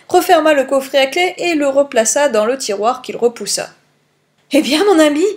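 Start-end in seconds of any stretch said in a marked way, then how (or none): 4.5-5.2 higher, louder breathless question; out of breath. A narrator's reading. Poirot asks Hastings after his timed feat, out of breath. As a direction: ask as if winded from a quick feat. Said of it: "demanda-t-il à bout de souffle".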